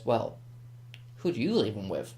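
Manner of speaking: soft, toying menace, swoop up then drop; Joker. Acted. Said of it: "that's my Joker impression".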